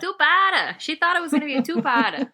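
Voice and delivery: high, fast teasing echo of her words; playful teasing. Real, not acted. Teasing her co-host about planning a two-parter, playful mock disbelief.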